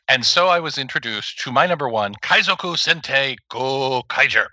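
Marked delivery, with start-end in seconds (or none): 2.2-4.5 slow, announcer-style Japanese delivery of Kaizoku Sentai Gokaiger; Japanese announcer pronunciation. Shown as a demonstration. Podcast host names his favorite show like its Japanese announcer. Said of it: "You have to say it with the accent".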